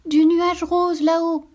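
proud little drop. Acted. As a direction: announce where you come from. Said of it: "répondit Perlette".

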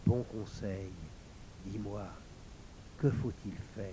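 softly murmured question to the sword. A narrator's reading. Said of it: "Lauriane murmura".

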